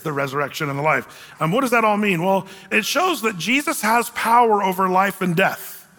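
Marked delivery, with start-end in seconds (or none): none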